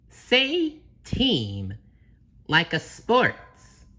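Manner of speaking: high, slowing instructive voice; SpongeBob coaching. Acted. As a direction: coach slowly in a high cartoon voice.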